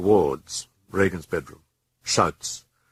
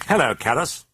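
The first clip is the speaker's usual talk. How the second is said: higher, louder sly greeting; a hint of a mocking demon; 8 semitones higher, 4 dB louder than usual. The demon greeting the priest Carus, lightly voiced and sly. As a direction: greet him like a gloating fiend.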